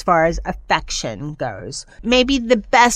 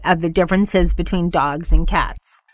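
usual speaking voice

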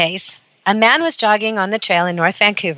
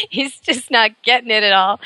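ordinary talk

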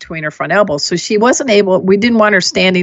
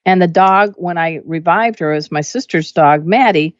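usual speaking voice

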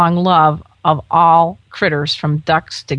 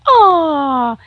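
1 imitated crowd aw; 8 semitones higher than usual. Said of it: "you hear him around going".

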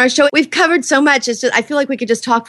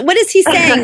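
normal talking voice

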